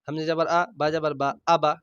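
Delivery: usual speaking voice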